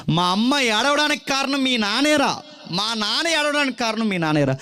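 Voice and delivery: put-on children blaming a classmate's father for their tears; children's taunt.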